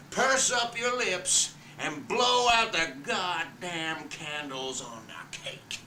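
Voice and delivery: a dying father crushing his wife's takeover, furious; Big Daddy monologue. Acted. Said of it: "Big Daddy comes back".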